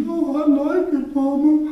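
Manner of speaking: put-on little boy. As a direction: speak like a tearful four-year-old. Little boy voiced in story.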